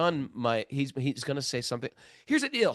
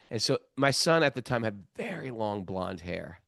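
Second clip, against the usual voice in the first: ordinary talk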